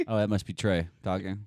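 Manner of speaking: ordinary delivery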